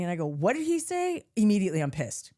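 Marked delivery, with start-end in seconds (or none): 0.3-1.2 pitched-up what did he say; mock-outraged kid; indignant disbelief. Retelling schoolyard insult, indignant disbelief.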